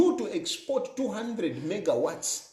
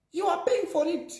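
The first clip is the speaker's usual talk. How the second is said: "paying" said much higher, rising to a peak before the fall; higher repeat; 8 semitones higher than usual. Shown as a demonstration. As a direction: hammer home "paying" on a high pitch.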